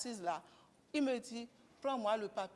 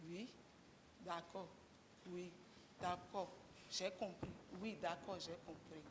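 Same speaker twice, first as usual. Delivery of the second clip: softer, short, calm yeses to the husband; calm quiet replies; 3 semitones lower, 11 dB quieter than usual. Retold or imitated. Wife calmly agreeing with an angry husband, quietly. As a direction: answer him in a low, calm, withdrawn voice. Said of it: "je deviens calme. Je ne bavarde plus beaucoup".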